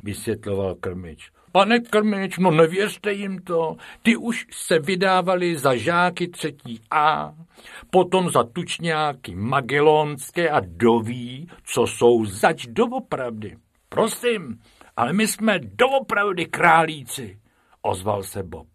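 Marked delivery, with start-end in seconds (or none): 1.5-13.6 higher, louder indignant teacher; teacher arguing. Acted. Teacher Kalousek warning the keeper, indignant. 13.9-17.4 higher, earnest rabbit voice; Bob protesting. Acted. Bob to the teacher and keeper, earnest plea.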